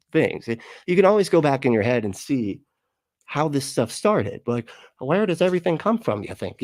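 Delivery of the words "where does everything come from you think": higher-pitched naive musing; primitive man wondering. Retold or imitated. Streamer voicing primitive man asking origins, naive. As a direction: play a wide-eyed early human asking. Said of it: "you got this primitive man".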